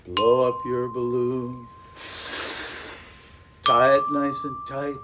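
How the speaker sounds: slow, lilting chant that rises with the birds; action rhyme. Shown as a demonstration. Teacher leading kids through a balloon rhyme, playful chant.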